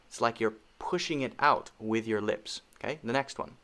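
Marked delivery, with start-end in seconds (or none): none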